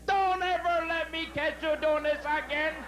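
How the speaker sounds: high, prim nun's scolding. Retold or imitated. Nun scolding the flasher after grabbing him, high prim voice.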